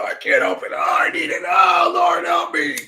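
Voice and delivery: hammed-up high shouted plea to the Lord; desperate cry. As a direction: wail it like a desperate addict. Mock desperate plea for weed.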